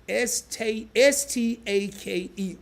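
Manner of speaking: spelling the word letter by letter, slow; spelled out. Shown as a demonstration. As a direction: spell "S T A K E" one letter at a time. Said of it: "or they call it staking".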